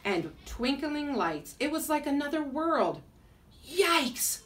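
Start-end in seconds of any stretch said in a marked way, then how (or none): 3.7-4.4 high-pitched yelp of alarm; storybook cry of fright; startled yell. A narrator's reading. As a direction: yell it out high, as if spotting a monster. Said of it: "Oscar yelled".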